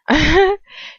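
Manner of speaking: short, high, loud scoffing laugh; acted burst of scornful laughter; mocking laugh. A narrator's reading. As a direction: laugh derisively at a helpless victim. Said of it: "He just laughs".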